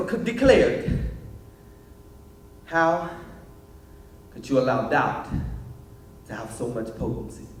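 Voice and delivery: driving slam delivery that drops at line endings; spoken word poetry. Acted. Young poet recounts how words hurt him, intense spoken word. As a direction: perform it as rhythmic slam poetry, surging then pausing.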